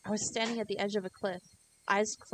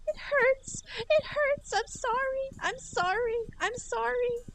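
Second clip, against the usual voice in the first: much higher and louder, repeated sorries; desperate pained pleading; 4 dB louder, slower than usual. Acted. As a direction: cry out sorry, high and desperate. Rena begging in pain.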